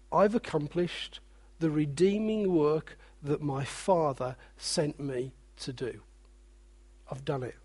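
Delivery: hushed, solemn words of fulfilment; quiet reverence. Acted. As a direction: let it fall to a hushed awe. Quiet reverent voicing of Jesus.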